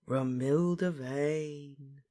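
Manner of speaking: very slow, lingering murmur; soft, dreamy. A narrator's reading. Lovesick Ron names his beloved, softly dreamy. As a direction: savour the name, stretched out adoringly. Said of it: "said Ron softly".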